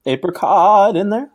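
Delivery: incredulous high-pitched mimicry; mocking echo. Co-host echoes pronunciation mockingly.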